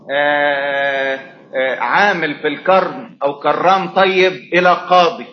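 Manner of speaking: ordinary delivery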